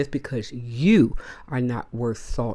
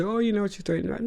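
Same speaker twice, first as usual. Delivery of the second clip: mock critics; 7 semitones higher, faster than usual. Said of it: "before people say".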